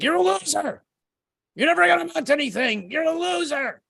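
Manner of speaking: very high, tiny taunting voice; mocking morning demon voice; nagging inner voices. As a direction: needle yourself like a little head demon. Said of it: "little voices inside your head".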